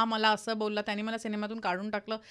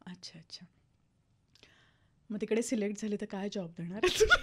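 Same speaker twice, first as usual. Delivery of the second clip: imitating mother; 5 semitones lower, much faster than usual. Mother quoted, after "My mother.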